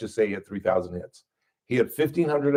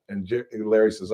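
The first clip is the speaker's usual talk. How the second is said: usual speaking voice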